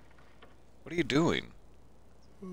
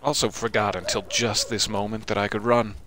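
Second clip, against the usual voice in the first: usual speaking voice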